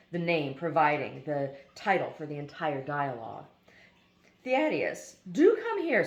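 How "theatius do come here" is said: high, loud call across a yard; a teacher calling a student over, raised and high. Retold or imitated. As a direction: summon the boy from a distance.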